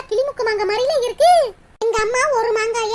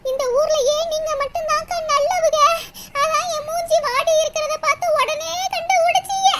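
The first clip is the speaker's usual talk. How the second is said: high, whining tearful gratitude; 4 semitones higher than usual. Acted. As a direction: thank her on the edge of sobbing. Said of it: "அழுகாத".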